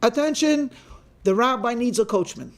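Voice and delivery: half-voiced demonstration of a shouted announcement; mock shout. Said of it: "I want you to shout".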